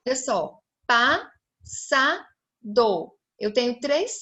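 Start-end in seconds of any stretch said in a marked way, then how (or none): 0.9-2.3 PASSADO slowly as pas-sa-do; three separate beats on passado, pauses between; split into syllables. Shown as a demonstration. Teacher separating the double S, syllable by syllable. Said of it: "Quando eu for separar".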